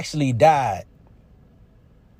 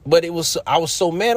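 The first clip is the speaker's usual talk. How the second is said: normal talking voice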